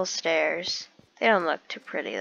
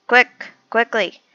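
urgent hurry; 4 semitones higher, 7 dB louder than usual. Real, not acted. Rushing to escape danger.

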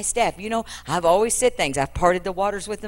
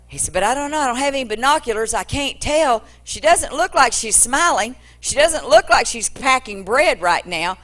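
higher, lively prophet peering into the distance; fretting aloud, then sending his servant off louder; Elisha watching, worried; 6 semitones higher, 5 dB louder than usual. Acted. Prophet voiced in retold story.

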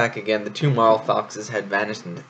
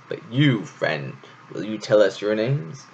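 ordinary delivery, nothing marked

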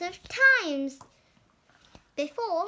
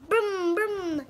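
car noise; 4 semitones higher, 6 dB louder than usual. Acted.

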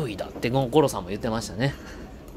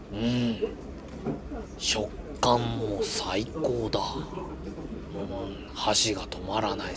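lightly voiced gourmet inner monologue; Goro narration voice; 2 semitones lower, slower than usual. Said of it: "五郎さんのナレーション".

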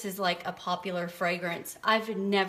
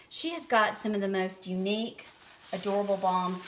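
ordinary talk, nothing marked

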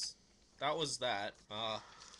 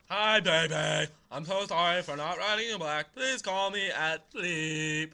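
hammed-up sing-song love letter; funny letter reading; 2 semitones higher, 10 dB louder than usual. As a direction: stretch the gushing words right out. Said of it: "I read them a funny way".

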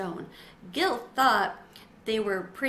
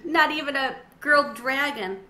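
ordinary delivery

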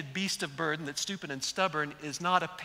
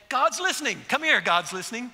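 put-on a higher, louder mother calling her boy; joyful call; 7 semitones higher, 8 dB louder than usual. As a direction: call him over with wonder. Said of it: "she's gonna say".

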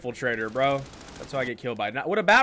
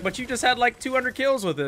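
higher-pitched mock viewer protest; imitated viewer; 10 semitones higher than usual. Streamer voicing a viewer who questions him, imitated protest. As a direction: object like a puzzled fan. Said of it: "You'd be like".

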